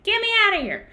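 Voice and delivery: high, loud comic animal voice; panicked manatee. Retold or imitated. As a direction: squeal it as a startled creature fleeing.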